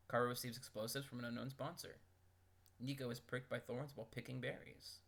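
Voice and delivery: ordinary talk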